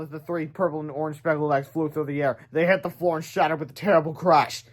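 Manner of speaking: ordinary delivery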